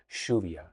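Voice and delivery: "lluvia" as SHOO-vya; "lluvia" opening on a soft sh; Argentine pronunciation. Shown as a demonstration. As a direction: say "lluvia" with a relaxed sh. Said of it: "So I'll say".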